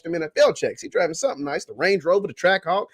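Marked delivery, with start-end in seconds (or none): none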